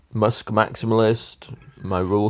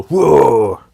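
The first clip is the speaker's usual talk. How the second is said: loud, raised whoa; big scare-off noise; 4 semitones higher, 5 dB louder than usual. Shown as a demonstration. Demonstrating to friend how to scare cows off, big loud noise. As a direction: make yourself big with a long whoa. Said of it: "make yourself big and go".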